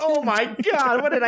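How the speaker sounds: high, shocked mother's gasp; shocked mom. Pretend. Horrified mom reacting to the scare lecture, high mock shock. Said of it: "Your mom's going".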